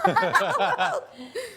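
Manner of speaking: big laugh; loud high laugh. Real, not acted. Laughing at the story.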